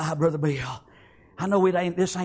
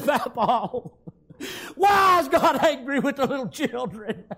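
put-on child's plea; 8 semitones higher, slower than usual. Grandson pleading to his grandfather.

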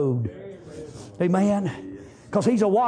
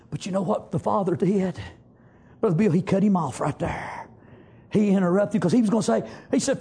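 usual speaking voice

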